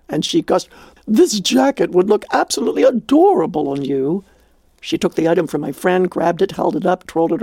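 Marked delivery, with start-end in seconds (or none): none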